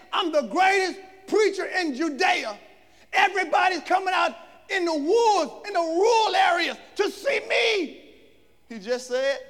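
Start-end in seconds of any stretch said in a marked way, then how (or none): 0.1-8.1 loud, higher, puffed-up bragging; mock boasting. Preacher mocking a proud John. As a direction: brag like a big shot.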